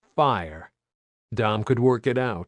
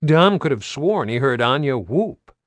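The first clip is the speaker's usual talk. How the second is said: usual speaking voice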